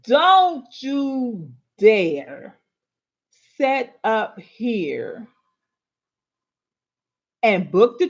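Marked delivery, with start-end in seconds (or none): 0.0-2.6 slow drawn-out DARE, louder; emphatic warning. Real, not acted. Speaker warning women listeners, slow and emphatic. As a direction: warn them with every word stretched.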